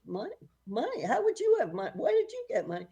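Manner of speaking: high, loud, rising questions; retold disbelief. Mother asks her kid where money came from, mock astonishment.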